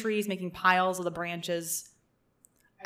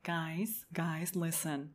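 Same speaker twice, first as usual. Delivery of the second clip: hushed, patient scolding parent voice; soft warning from a gentle parent; alien parent; 6 dB quieter than usual. Pretend.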